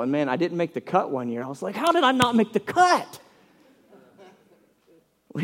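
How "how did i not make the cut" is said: high-pitched, incredulous outcry; indignant protest. Retold or imitated. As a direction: act out a wounded teenage protest. Retells his protest at being cut.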